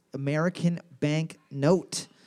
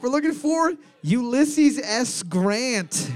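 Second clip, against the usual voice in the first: drawn-out game-show announcement, higher; host announcer voice; 6 semitones higher, slower than usual. Acted. Quiz host announcing the answer to his co-host, drawn out.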